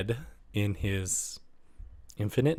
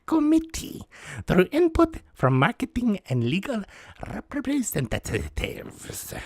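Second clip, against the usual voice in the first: cartoon villain snarling at his nemesis, pitched way up; Doctor Doofenshmirtz; 10 semitones higher than usual. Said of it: "sounds like Doctor Doofenshmirtz".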